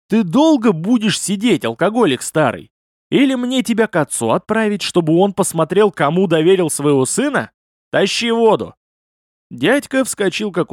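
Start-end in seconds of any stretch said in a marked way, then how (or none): 0.1-8.7 high-pitched, spiteful scolding; feigned fury. A narrator's reading. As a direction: berate him with put-on rage. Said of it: "изобразив ярость, злобно зашипел". (с напускной яростью)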